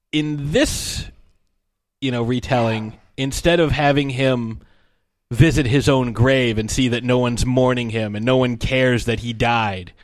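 ordinary delivery

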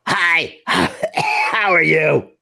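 high laughing put-on voice, slowed; strange voice. Jim greets the team in a silly high voice. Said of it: "talking in strange voices".

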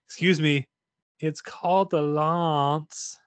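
slow, drawn-out mock-solemn line; movie quote. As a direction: say it grandly, dragging it out. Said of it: "our go-to quote is".

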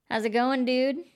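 a relaxed surfer-type greeting; laid-back dude. A narrator's reading. Blonde man greets the strangers, easygoing dude voice.

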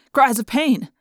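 plain reading voice

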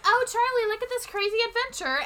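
high, quick, mock-excited big sister; hammed-up high-pitched cheer; gushing video-diary voice. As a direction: imitate a perky vlogger talking to a little one. Imitating Teddy narrating to video camera.

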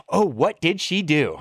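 high, slow gasping clerk voice through a laugh; the hotel clerk, aghast and amused, high and slow; mock shocked clerk. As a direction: play the clerk gasping in disbelief. Clerk's shocked reply, retold.

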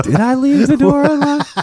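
high anxious inner voice; worried self-quote. Retold or imitated. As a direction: panicky self-questioning. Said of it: "I'm like".

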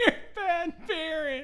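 high, slow mock-parent voice. Co-host parroting the commercial parents, mocking. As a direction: echo the cheesy parents, drawn out.

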